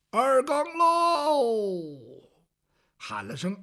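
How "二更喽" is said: watchman's call. Acted. Night watchman calling the hour.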